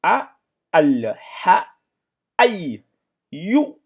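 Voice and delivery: loud, high drilled syllables, one by one; syllables spelled out. Shown as a demonstration. Teacher drilling a student in Quran reading, loud and slow. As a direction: sound out each syllable for the class.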